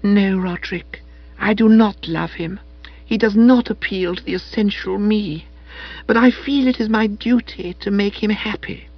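put-on high, soulful soppy girl; Madeline's voice. A narrator's reading. Madeline telling Spode her noble duty, high and soulful. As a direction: speak high and dreamily dutiful.

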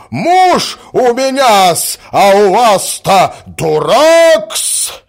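loud, high, drawn-out retorts; shrieking tirade. A narrator's reading. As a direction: fire insults back at full voice. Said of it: "взвизгнула Наталья Дмитриевна". (визгливо, скандально, громко)

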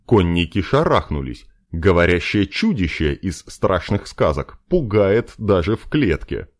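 ordinary delivery, nothing marked

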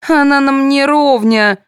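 high, slow haughty put-down; condescending. A narrator's reading. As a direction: look down on them, drawing it out. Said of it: "снисходительно заметила Марина".

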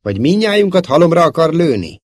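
high, loud indignant question; stern rebuke. A narrator's reading. Princess rebuking Szóló for shooting, indignant. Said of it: "szólt rá sötét arccal".

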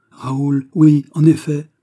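light up on recognizing someone; surprised recognition. A narrator's reading. De Guiche sees Raoul arriving, loud surprised recognition.

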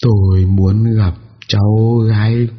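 very slow, mournful ghostly voice; drawn-out grieving old ghost; ghost old woman. A narrator's reading.

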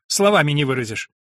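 usual speaking voice

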